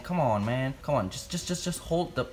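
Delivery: normal talking voice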